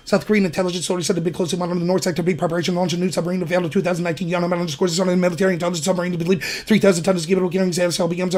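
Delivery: rapid, rushed news-style read; fast auctioneer-style reading; fast debate reading. Shown as a demonstration. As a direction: rattle it off like a debate speed reader. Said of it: "they might read like this".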